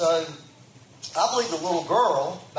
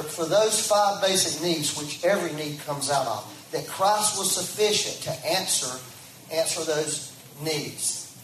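usual speaking voice

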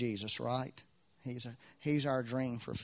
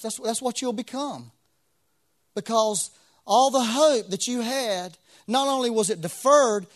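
impassioned preaching; 9 semitones higher, 8 dB louder than usual. Acted. Pastor warning the congregation about lost hope, higher and louder. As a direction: hammer home the loss of hope.

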